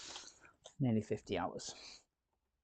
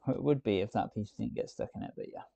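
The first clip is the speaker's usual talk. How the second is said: ordinary talk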